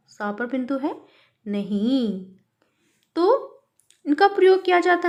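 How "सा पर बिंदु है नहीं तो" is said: ordinary delivery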